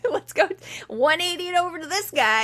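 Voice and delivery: high, excited reporter voice with a laugh; hammed-up thrilled newsman; eager reporters. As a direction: pounce on the story like a giddy reporter. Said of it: "the news people are like".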